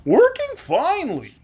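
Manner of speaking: high, slow straw man voice; cheery high Scarecrow. Acted. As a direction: pitch it way up like a stuffed scarecrow.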